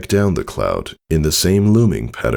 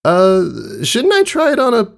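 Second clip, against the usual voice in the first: much higher, nervous little filly voice, cut off; unsure kid trying to back out; hesitant young filly; 3 dB louder than usual. Acted. Scootaloo balks at her father's dangerous request, nervous and high.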